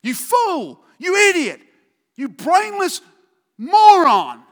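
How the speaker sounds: high, loud string of insults; angry name-calling. Acted. Preacher acting out angry insults at someone. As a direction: hurl each insult harder.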